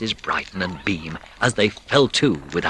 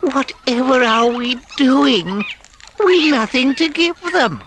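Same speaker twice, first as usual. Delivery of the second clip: very high, louder, slow fretting; despair; 5 dB louder, slower than usual. A narrator's reading. Mole to Rat, high desperate fretting. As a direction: fret that the cupboard is bare. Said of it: "he cried in despair".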